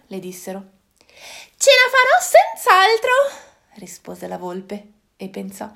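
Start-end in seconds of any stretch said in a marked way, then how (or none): 1.6-3.1 much higher, louder sly fox voice; a cocky little fox. Acted. Fox promises the animals success, in a high sly voice.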